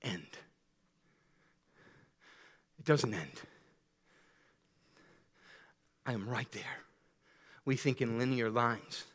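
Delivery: soft, low, intimate sermon voice; hushed preaching. Acted. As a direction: draw them in with a hushed preacher's voice. Quiet intimate sermon opening.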